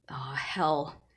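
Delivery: whispered; whispered curse. Acted. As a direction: breathe it out. Narrator's muttered curse.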